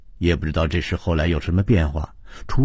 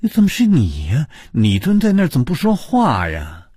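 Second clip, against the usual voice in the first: high-pitched, astonished questioning; shocked; 7 semitones higher than usual. A narrator's reading. Startled woman finding her colleague was the ghost, shocked and high. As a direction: blurt it out, still reeling. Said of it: "高伟震惊得说不出话来".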